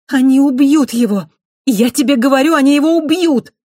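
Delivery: high, loud frantic shouting; громкий крик. A narrator's reading. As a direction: shout it in panic for his life. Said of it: "громкий голос Рионы". (громкий панический крик)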